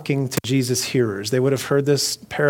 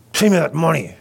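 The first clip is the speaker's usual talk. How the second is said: raised, strained mock-threatening voice; violent demand; 4 semitones higher, 4 dB louder than usual. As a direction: demand it as if grabbing a throat. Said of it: "grabbed you by the throat".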